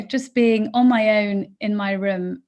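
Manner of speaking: usual speaking voice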